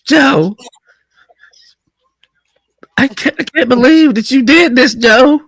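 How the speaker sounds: put-on high, wailing drunk lament; drunk whining woman. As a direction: whine it like a tipsy woman. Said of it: "Talk about".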